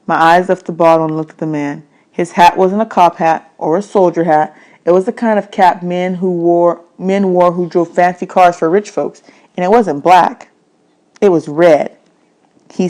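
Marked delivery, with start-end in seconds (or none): none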